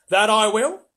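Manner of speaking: loud, high Cockney assent. A narrator's reading. Mister Beaver promising his wife some fish, hearty Cockney. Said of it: "I'm allowed to do Cockney for him".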